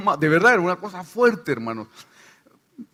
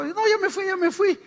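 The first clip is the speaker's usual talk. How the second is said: much higher, mocking demon insisting it left; lying demon voice; 14 semitones higher than usual. Lying demon fooling the deliverance team: much higher mock voice. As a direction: squeak it out like a lying spirit.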